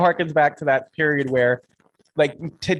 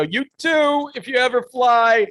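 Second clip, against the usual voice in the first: mock flustered send-off; acted awkward reply; 9 semitones higher, 3 dB louder than usual. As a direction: blurt it high, then let it slide down awkwardly. Acting out an awkward automatic reply.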